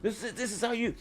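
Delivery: mock game reviewer. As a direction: parody an overexcited game guide. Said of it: "All the game people are gonna be like".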